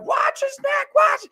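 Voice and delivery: shrill, very high mock alarm; the wife's warning echoing in his head. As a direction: squeal it like a panicked mom. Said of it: "I start hearing my wife".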